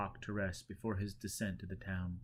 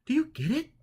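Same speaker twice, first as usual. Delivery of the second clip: high, louder checking question; woman asking; 8 dB louder than usual. A narrator's reading. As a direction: ask him high and bright. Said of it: "she asked".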